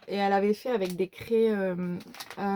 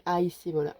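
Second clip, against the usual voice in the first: ordinary talk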